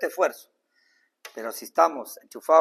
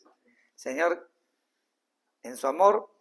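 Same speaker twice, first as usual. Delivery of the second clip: normal talking voice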